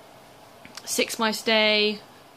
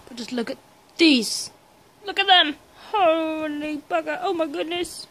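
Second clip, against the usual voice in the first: excited gushing; 6 semitones higher, 6 dB louder than usual. Real, not acted. Excited over owl feathers.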